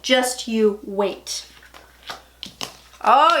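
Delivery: normal talking voice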